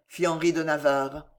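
plain reading voice

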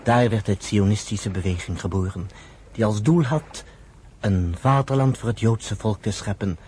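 ordinary talk

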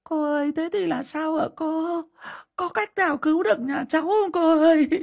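very high, whimpering plea near tears; tearful pleading. Acted. Bà Hậu begging the fortune teller to save her family, whimpering high. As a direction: beg with your face crumpling. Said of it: "mếu máo".

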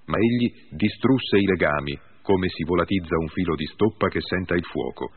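ordinary delivery, nothing marked

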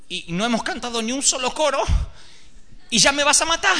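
plaintive protest. Acted. Isaac protests to his father.

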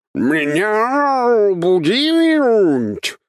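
huge drawn-out, high, loud roar; monster roar. A narrator's reading. Awakened monster bursting from the floor, enraged roar. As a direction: roar each word out very slowly like a beast. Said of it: "взревело чудовище".

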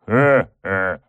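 evil demonic laugh; sinister chuckle. A narrator's reading. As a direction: chuckle with menace. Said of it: "тихо, зловеще рассмеялась".

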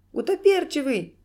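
high, loud squealed cry; sudden squeal. A narrator's reading. Clown suddenly naming the culprit, squealing. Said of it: "вдруг взвизгнул клоун".

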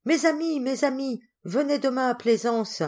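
high calling out to friends; calling from afar. Acted. Girl calls to her friends from far away, high call. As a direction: call across the lawn, voice lifted high. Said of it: "leur cria Geneviève".

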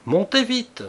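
high, loud cry of alarm; high-pitched urgent call; urgent shout. A narrator's reading. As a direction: shout it sharply to hurry them up. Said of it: "je criai".

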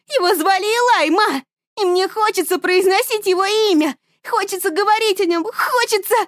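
high and loud, defiant; defiant shouting. A narrator's reading. Daughter defies her mother, shouting high and loud. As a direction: refuse to be silenced.

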